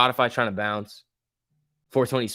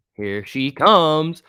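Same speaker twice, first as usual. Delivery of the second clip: slow, loud here she comes; drawn-out anticipation; 6 semitones higher, 8 dB louder, much slower than usual. Real, not acted. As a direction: stretch it out as it arrives. Stock starts to move.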